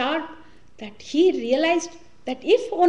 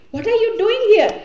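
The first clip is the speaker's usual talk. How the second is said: a startled, much higher and louder cry; startled outburst; 8 semitones higher, 7 dB louder than usual. Retold or imitated. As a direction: jolt up in alarm at the intruder. Startled wife confronts intruder husband.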